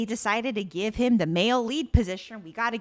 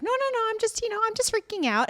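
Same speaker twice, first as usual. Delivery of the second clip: high flustered denial; 11 semitones higher, faster than usual. Retold or imitated. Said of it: "she's like".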